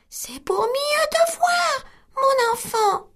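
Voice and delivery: the wolf squeaks like grandmother; wolf in bed faking the old woman; small voice. Acted. Wolf as granny.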